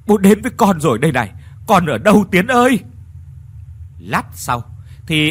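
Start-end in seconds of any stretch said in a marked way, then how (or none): 0.1-2.8 fast, louder, higher cry; frantic calling. Acted. Father crying out to his lost son, fast frantic call. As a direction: rush the words out, searching.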